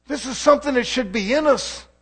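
loud, high, fiery preaching; rising gospel fervour on one phrase; preaching at full force. Acted. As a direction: lift it into a full-voiced sermon cry. Preacher builds to a peak.

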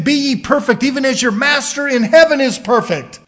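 preacher building in volume; full-force preaching. Real, not acted. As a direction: thunder the verse from the pulpit. Quotes scripture at full force.

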